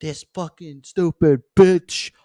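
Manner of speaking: high, slow, drawn-out mocking rant with a loud ugh; exasperated ranting boyfriend. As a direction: whine like he's fed up again.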